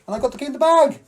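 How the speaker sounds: high, loud triumphant finish; exultant, pitch soaring at the end; triumphant punchline. Acted. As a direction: deliver the punchline with a big lift. Punchline of the trick story.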